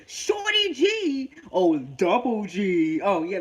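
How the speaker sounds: put-on spoof a goofy Nickelodeon kid star; a hint of a goofy kid-show host mocking the new name; kids-show character. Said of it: "He sounds like Double G".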